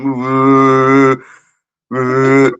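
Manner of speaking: long, low droning "woo"; hovering spaceship noise. Acted. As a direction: imitate a spaceship's hum with a drawn-out woo. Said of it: "it's hovering over South Africa and it's going".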